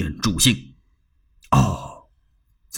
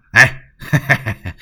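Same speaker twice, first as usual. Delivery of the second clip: gleeful chuckle; 5 dB louder than usual. Acted. Zhang Fei delighted by praise.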